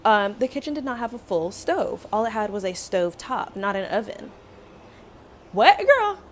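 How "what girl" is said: loud, high-pitched disbelief; comic outraged outburst; incredulous. Real, not acted. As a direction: burst out appalled at the absurdity. Disbelief at kitchen without oven.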